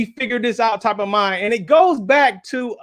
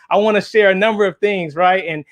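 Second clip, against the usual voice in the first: ordinary talk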